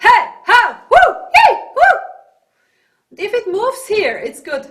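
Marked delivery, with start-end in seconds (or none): 0.1-2.0 loud, high whooping calls; short bursts, pitched way up; calling out. Shown as a demonstration. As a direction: call out and whoop. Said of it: "you call someone".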